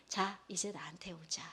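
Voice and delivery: a tender whispered call; inviting whisper. Acted. God softly invites the believer to come, whispered. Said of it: "하나님께서".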